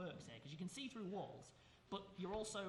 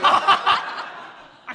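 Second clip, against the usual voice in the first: laughing; 10 semitones higher, 26 dB louder than usual. Real, not acted. Mock boast through laughter.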